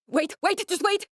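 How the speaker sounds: urgent high-pitched pleading to stop; alarmed high wait. Acted. Scientist urgently stopping Kyle, alarmed high pleading.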